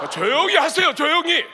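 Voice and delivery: loud, high shouted hushing; passerby's rebuke. Acted. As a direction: yell over a crowd to silence them. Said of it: "지나가는 행인이에요".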